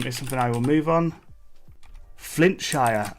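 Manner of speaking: ordinary delivery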